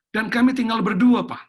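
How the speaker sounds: loud, high imitation of a child's plea; imitating an alarmed child calling Papa; child's urgent phone call. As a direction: retell the child's alarm at full volume. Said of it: "tiba-tiba anak saya telepon saya".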